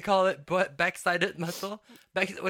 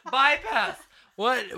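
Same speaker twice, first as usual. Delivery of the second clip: normal talking voice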